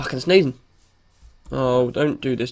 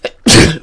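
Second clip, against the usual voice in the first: sneeze; 9 dB louder than usual. Real, not acted. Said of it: "I'm getting really annoyed now".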